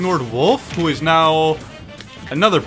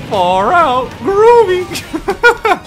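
Shaggy voice; 14 semitones higher, 5 dB louder, much slower than usual. Pretend. Voicing Shaggy after his punch.

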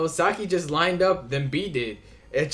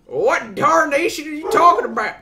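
loud, high Southern shout of "darnation"; angry Southern accent; 11 semitones higher, 9 dB louder than usual. Acted. Character losing patience with the dumb dog, angry Southern shout. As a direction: yell like an angry country man. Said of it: "My accent sort of slips whenever I get angry".

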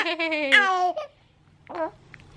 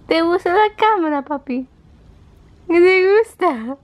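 put-on high, loud cooing baby talk; 9 semitones higher, 5 dB louder than usual. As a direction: coo brightly at the baby. Mother coos at baby.

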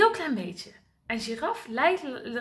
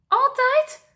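much higher, hopeful query; anxious question; 11 semitones higher, 7 dB louder than usual. Acted. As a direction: ask for reassurance. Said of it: "vraagt giraf".

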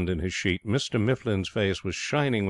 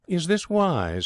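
high, questioning Mifflin voice; higher-pitched, fussy query; doubtful schemer; 10 semitones higher, slower than usual. A narrator's reading. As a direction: airily raise a doubt.

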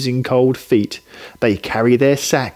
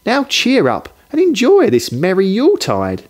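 higher, lightly voiced cheerful wife; cheery wife; 7 semitones higher than usual. Wife urging husband to cheer up, higher and cheery. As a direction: coax him kindly, pitched up. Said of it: "his wife said".

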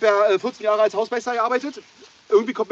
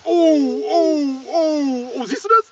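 high, loud shocked oh; startled by the spray; shocked exclamations; 8 semitones higher, 5 dB louder, much slower than usual. Real, not acted. Can sprays open.

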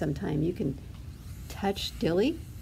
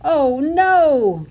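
high, loud alarmed cry; gasped dismay; 7 semitones higher, 9 dB louder than usual. A narrator's reading. Duckling sees her feather blow off, gasped dismay. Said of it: "gasped Dilly".

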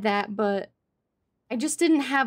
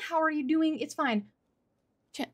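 usual speaking voice

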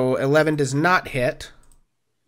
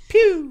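high-pitched playful "pew"; mock shot sound; 14 semitones higher, 4 dB louder than usual. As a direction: toy laser zap by mouth. Imitated shot after announcing damage.

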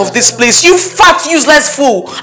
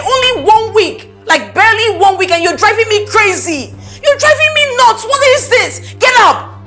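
high-pitched, at wit's end; exasperated rant; 7 semitones higher than usual. Acted. Employer driven crazy by new maid, exasperated rant.

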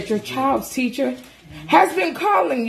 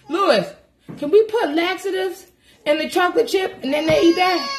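ordinary talk, nothing marked

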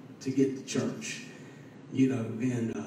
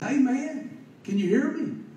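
retold calling out; 8 semitones higher than usual. Calling over a bad phone line.

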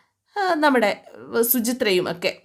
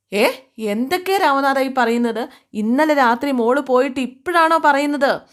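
higher, louder disbelieving demand; father startled into a raised, high protest; shocked outburst; 6 semitones higher, 4 dB louder than usual. Retold or imitated. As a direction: hit him with alarmed disbelief. Father shocked by daughter's disappearance.

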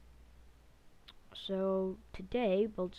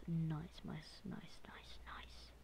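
whispered cheer; 10 dB quieter, much slower than usual. Real, not acted. Fading celebration.

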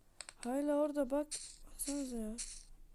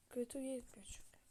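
whisper; 9 dB quieter than usual. Real, not acted. Quiet aside while playing.

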